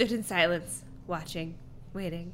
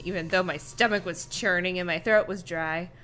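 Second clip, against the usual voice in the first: normal talking voice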